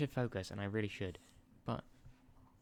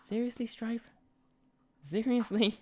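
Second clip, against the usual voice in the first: much higher, incredulous exasperation at the dog; much higher, louder exasperated plea; 13 semitones higher, 5 dB louder than usual. Real, not acted. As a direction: scold the pet in disbelief.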